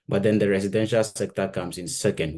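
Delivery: normal talking voice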